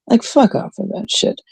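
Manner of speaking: ordinary talk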